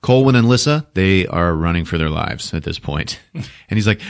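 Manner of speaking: normal talking voice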